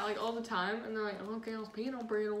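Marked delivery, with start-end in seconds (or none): 1.2-2.4 softer imitated Southern accent; Southern Illinois accent. Mimicking relatives in Illinois, soft Southern accent. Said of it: "People like that in Illinois speak like that".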